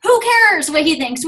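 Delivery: acted-out dismissive comeback; who-cares attitude. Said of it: "who cares attitude".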